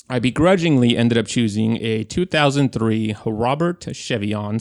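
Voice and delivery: ordinary talk, nothing marked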